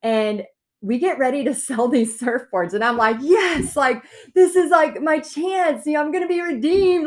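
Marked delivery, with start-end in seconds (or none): 3.2-7.1 high, loud, eager; excited hope. Retold or imitated. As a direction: burst out with hope, dragging out my chance. Hopeful moment selling surfboards.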